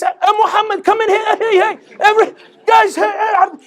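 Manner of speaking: high, loud excited shouting to others; excited calling out. Retold or imitated. Shopkeeper calling people to hear the Chinese man. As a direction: call everyone over in giddy amazement. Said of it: "the shopkeeper ... went".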